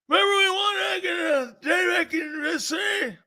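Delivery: very high, garbled gummy mumble; toothless Cowboy fan. Retold or imitated. Cowboy guy insists on old wins, high unintelligible toothless mumble. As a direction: run the words together high, with no teeth. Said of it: "open your mouth or put your false teeth in".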